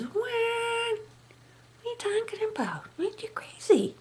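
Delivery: high, slow, breathy put-on disbelief; mock astonished whisper. Mother-in-law brushing off a complaint with feigned shock. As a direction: sneer it like a cobra strike. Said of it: "one of her sayings".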